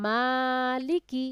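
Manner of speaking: মালিকি read very slowly, the long "মা" held for one alif; drawn-out vowel. Shown as a demonstration. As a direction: stretch the madd in মালিকি like a Quran teacher. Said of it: "মদের হরকত হইলে এক আলিফ টেনে পড়তে হয়".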